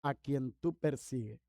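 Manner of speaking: low, soft, slow solemn answer; voice of Jesus. Retold or imitated. Jesus answers blinded Saulo, low and hushed. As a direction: reveal yourself softly and gravely. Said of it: "Y le dijo".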